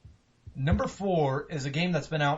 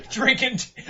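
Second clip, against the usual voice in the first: ordinary talk, nothing marked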